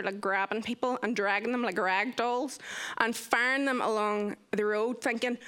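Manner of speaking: ordinary talk, nothing marked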